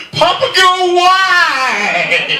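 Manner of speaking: high, loud comic protest; mock outrage. Comedian mock-wailing at a trail name. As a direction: wail the name in disbelief.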